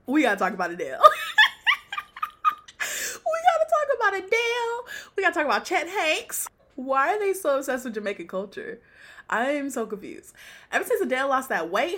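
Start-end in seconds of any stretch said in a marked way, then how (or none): 3.3-6.5 high, fast, through real laughter; laughing squeal. Real, not acted. Host repeats the topic after cracking up, laughing squeal.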